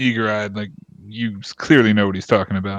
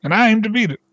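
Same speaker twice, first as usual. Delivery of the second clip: much higher, louder, hopeful boast; excited villager; 5 semitones higher, 4 dB louder than usual. Acted. Villager boasting to the party about his pie record, excited.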